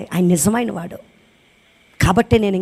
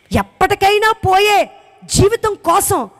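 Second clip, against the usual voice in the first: preaching shout; 9 semitones higher, 4 dB louder, slower than usual. Real, not acted. Preacher rebuking the congregation.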